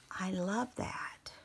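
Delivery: slow breathy whisper of delight. Real, not acted. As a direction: whisper it like a secret. Admiring fabric softly.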